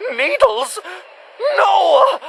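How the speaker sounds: high, shrill panic at needles; panicked. Acted. Hunter panicking on hearing of needles. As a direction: yelp in terror.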